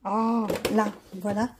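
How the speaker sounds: strained grunt of effort; pulling hard at a stuck lid; opening a stuck jar. Real, not acted. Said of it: "j'arrive pas à l'ouvrir".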